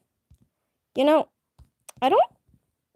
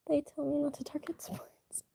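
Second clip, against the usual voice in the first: soft secret aside; whisper; 4 semitones higher, 11 dB quieter than usual. Real, not acted. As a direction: whisper it like a confession.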